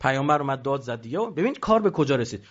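usual speaking voice

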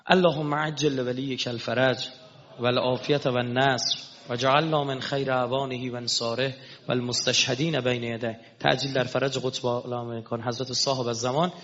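sermon's final prayer call; closing prayer. Acted. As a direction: intone the closing supplication.

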